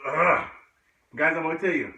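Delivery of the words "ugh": real grunt of exertion; strained grunt. Real, not acted. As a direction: grunt with the effort of a push-up. Straining through a hard push-up.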